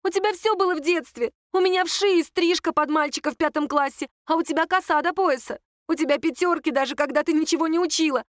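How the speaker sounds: high-pitched, indignant tirade; agitated, aggrieved accusations in a high voice; flaring outburst. A narrator's reading. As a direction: pour out years of envy at sister. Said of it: "вдруг взвилась Надя".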